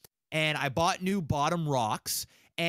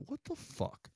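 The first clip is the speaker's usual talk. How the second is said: retold shock; 7 semitones higher, 11 dB quieter than usual. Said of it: "I'm like".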